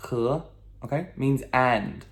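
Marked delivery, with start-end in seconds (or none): 0.1-0.4 "He" as huh, climbing in pitch; questioning second tone. Shown as a demonstration. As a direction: say "He" like asking huh?.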